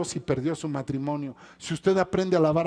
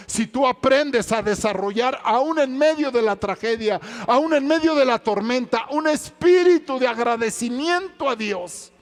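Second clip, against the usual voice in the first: higher, louder preaching cry; fervent sermon peak; preaching at full force; 9 semitones higher, 7 dB louder than usual. Acted. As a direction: drive it home to the congregation. Sermon climax.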